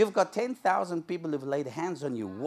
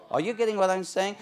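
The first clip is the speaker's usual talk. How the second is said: ordinary talk, nothing marked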